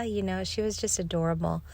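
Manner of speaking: plain reading voice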